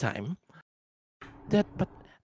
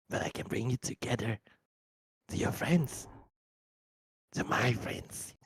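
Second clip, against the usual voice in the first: creepy whisper; 4 semitones lower, 5 dB quieter than usual. Acted. Creature luring the child.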